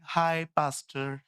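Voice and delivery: slow, drawn-out hi; mock casual sister. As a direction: imitate a breezy churchgoer. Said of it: "उस दिन आई एक बहन". (बहन की नकल में धीमा हाय)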